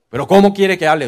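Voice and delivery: loud, offended comeback; mock-offended parishioner snapping back; indignant retort. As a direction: throw the question back, affronted. Congregant's indignant reply retold.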